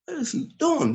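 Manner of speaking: high, amazed retold reaction; startled whoa. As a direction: act stunned by the salute. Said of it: "And I'm like".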